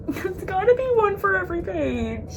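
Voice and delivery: high-pitched disbelief, bordering on laughter; amazed squeal. Real, not acted. Marvels at tabs on every page.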